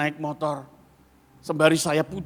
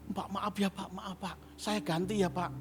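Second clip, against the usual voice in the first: soft, fast begging waiter; hushed apology; 2 semitones higher, 10 dB quieter than usual. Retold or imitated. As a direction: beg quietly, almost under breath.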